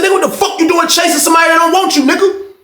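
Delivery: fast high-pitched mock berating; retold angry scolding.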